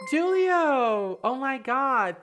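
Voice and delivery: very high, loud joyful call; thrilled shout. Real, not acted. Streamer thanking a donor, thrilled shout. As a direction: shout the donor's name with joy.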